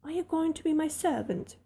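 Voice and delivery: put-on imperious child asking her maid. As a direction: demand it like a spoiled young mistress. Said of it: "in her imperious little Indian way".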